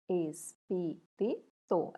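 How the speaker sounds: Espírito split into ES, PÍ, RI, TO; syllable by syllable. Shown as a demonstration. Teacher separates syllables for pupils, Espírito one piece at a time.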